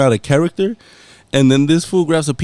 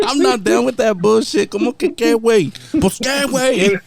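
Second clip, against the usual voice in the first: higher, raised angry imitation, shouting "bullshit"; mad retort; 8 semitones higher than usual. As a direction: square up and yell it at your brother. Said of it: "was fucking mad".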